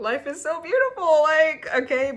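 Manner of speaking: high, loud, tearful laughing gush; overcome, half laughing and half crying; teary laugh. Real, not acted. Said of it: "Ugh, I always cry during these".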